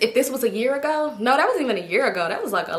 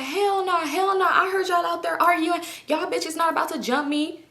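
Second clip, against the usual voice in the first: pitched way up, suspicious and defiant; high mock Kelly refusal; 8 semitones higher than usual. Girl in the street refuses the ride, fearing a jump, high defiant.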